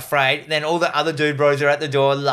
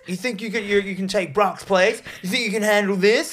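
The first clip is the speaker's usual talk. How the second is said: higher-pitched frat bro taunt; mock challenge; 7 semitones higher than usual. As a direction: goad him, imitating a cocky bro. Acting angry bro taunting newcomer.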